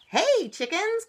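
high, loud storybook call. A narrator's reading. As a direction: call over to the little chickens. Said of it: "called some big cats".

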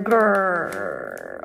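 deep playful robot growl; monster growl. Pretend. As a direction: growl low like a villain. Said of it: "you want them to go just grr".

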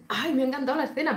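quick, high gushing "ay"; gushing delight. Retold or imitated. Fan telling herself she loved the scene, gushing quickly. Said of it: "te dices".

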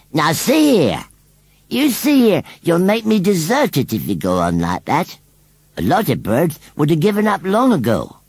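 high, loud indignant rustic voice; riled rustic scarecrow. A narrator's reading. Scarecrow threatens children with deserting baby, riled. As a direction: warn them off as an offended scarecrow.

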